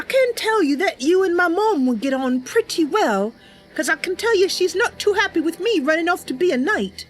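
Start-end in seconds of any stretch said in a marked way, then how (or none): none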